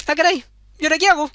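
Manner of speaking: put-on high, fast fretting girl; panicked girl. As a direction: fret it out quickly in a high voice.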